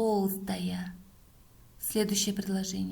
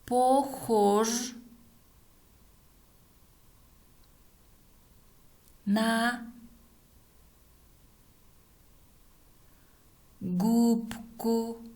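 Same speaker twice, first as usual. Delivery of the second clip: word by word with long pauses; dictating; 2 semitones higher, much slower than usual. Shown as a demonstration. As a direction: dictate slowly, one word at a time. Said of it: "Следующее предложение". (диктует по словам)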